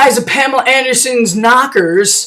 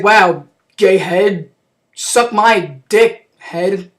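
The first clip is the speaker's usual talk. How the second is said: very slow and low, pausing between jabs; slow deadpan retort; 5 semitones lower, much slower than usual. Retold or imitated. Chelios answering the evil head, slow deadpan insult. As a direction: drop it low and drag out each word.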